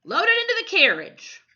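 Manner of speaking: loud, high, fast barked command; barked order. A narrator's reading. Photographer barking orders at the boys, loud and high. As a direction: bark it at the boys. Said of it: "the photographer barked".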